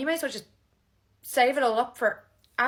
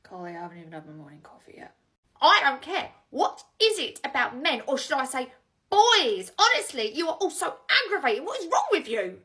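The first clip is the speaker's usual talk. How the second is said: exasperated rant; 8 dB louder than usual. Acted. Exasperated rant about men.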